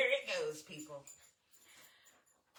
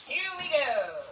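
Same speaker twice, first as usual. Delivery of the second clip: announcing start; 9 semitones higher, 13 dB louder, slower than usual. Real, not acted. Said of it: "clears throat".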